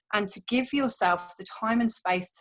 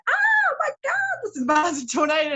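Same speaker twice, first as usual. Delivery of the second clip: mock shock; 9 semitones higher, 5 dB louder than usual. Someone watching a tornado approach, in hammed-up high alarm. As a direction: gasp in hammed-up alarm at the storm. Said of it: "you're like".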